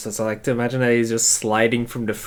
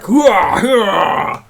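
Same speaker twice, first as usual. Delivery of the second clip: loud, high battle cry; loud gung-ho soldier; 12 semitones higher, 10 dB louder than usual. Retold or imitated. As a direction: bellow it like a charging soldier. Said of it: "going like".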